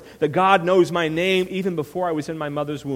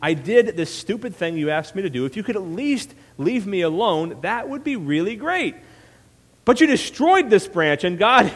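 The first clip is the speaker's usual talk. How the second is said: high, indignant acted protest; Jonah; 4 semitones higher than usual. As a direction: complain bitterly, rising to an outburst. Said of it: "And Jonah's angry again".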